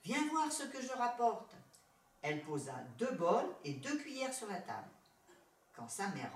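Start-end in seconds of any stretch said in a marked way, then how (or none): 0.0-1.5 higher-pitched, eager call; a lightly acted child's call. A narrator's reading. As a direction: call mother over excitedly. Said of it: "cria-t-elle en arrivant".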